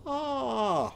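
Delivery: "Ah" as a high, drawn-out phonics vowel; isolated vowel sound. Shown as a demonstration. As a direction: sing out the middle sound "Ah" for the class. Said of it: "Show me the next sound you hear".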